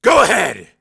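braced, loud go ahead; tense and loud. A narrator's reading. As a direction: push her to tell it. Tense father.

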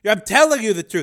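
high loud shout; Dev shouting. A narrator's reading. Dev shouting at the program. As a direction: shout the denial.

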